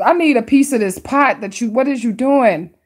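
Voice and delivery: ordinary delivery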